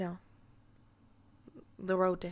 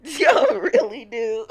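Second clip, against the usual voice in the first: loud, slow, half-laughing exasperation; loud exasperated laugh-yell; 5 semitones higher, 15 dB louder, slower than usual. Real, not acted. Streamer yelling at chat in exasperation.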